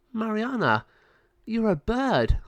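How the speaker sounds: wide-eyed disbelief at the transformation; amazed. Acted. Sabio recognises Mariana as bird.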